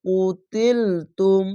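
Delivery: কুতিলতুম chanted very slowly with a rising end; very slow tajweed chant; Quranic recitation. Shown as a demonstration. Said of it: "কফ পেশ কু".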